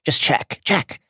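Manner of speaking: short, desperate begging; pleading. Acted. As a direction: plead urgently, rising on the repeat. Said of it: "The person is pleading".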